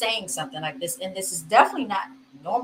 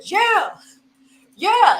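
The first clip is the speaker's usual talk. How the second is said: higher, louder mock cheer from the crowd; imitating crowd cheer; 9 semitones higher, 8 dB louder than usual. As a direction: echo the fan egging him on, a bit louder. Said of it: "the man that said".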